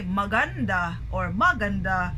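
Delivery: maganda stressed on GAN, then on MA; misplaced stress. Shown as a demonstration. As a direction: wrongly say ma-GAN-da or MA-gan-da. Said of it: "We don't say".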